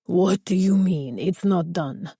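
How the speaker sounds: put-on slow heavy Spanish accent, roaring. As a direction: roar it in a thick Spanish accent. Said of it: "A heavy Spanish accent roared".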